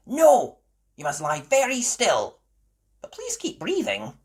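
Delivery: high, loud little nurse giving orders; a child playing doctor, very firm; bossy little sheep. Susie ordering the patient, high and bossy.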